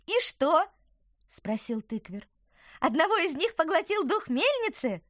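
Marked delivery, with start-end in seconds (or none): 0.1-0.7 high creature voice. Acted. Eager apple creature asks. 2.8-5.0 high creature voice. Acted. Eager apple creature asks.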